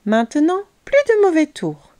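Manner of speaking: put-on high, firm boy's voice; exclaiming. A narrator's reading. Léo tells trapped Croquedouille it is over, exclaiming. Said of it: "s'exclama Léo".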